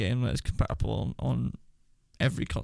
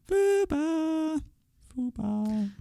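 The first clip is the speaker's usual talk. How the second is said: high sing-song call; much slower than usual. Pretend. Playful repeated name call.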